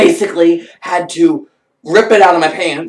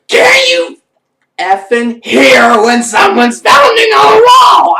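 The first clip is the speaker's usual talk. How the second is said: high, loud, drawn-out scream; furious yelling; 11 semitones higher, 8 dB louder, slower than usual. Retold or imitated. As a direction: scream each word at the coworker, acting out rage. Acts her furious question.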